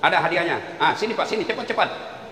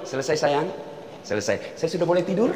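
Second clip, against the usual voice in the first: meek husband; 10 semitones lower, 7 dB quieter than usual. Pretend. Husband meekly ending wife's nagging.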